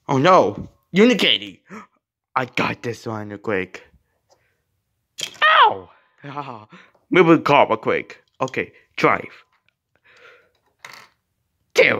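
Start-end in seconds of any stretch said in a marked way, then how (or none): 5.4-5.9 very high, loud yelp; pained yelp. Pretend. Toy character gets hurt in play, pained yelp. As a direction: yelp out a pretend ow.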